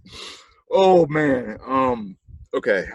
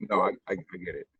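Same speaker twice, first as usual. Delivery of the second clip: usual speaking voice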